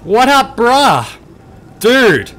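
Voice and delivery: high, loud hyped-up greeting; excited greeting. Acted. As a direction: shout hello like a thrilled bro. Greeting the robot.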